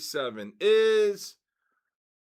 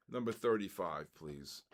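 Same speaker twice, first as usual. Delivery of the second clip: number read in a much lower, softer voice; deliberately low; 11 semitones lower, 11 dB quieter than usual. Shown as a demonstration. Host reads the number again, deliberately low and soft. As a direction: drop the voice way down for a joke. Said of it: "I'm gonna go low".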